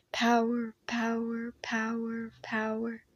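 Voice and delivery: power repeated, fading to nothing; fading echo. Acted. Commander's word echoing in the hall, fading. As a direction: echo it away into silence.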